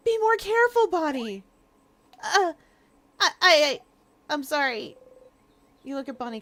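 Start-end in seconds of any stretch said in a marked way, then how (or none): 0.1-1.4 higher, louder scolding yell. Acted. Friend yelling at reckless Bonnie, scolding shout. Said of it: "You can't help but yell". 2.2-5.0 flustered stammer. Acted.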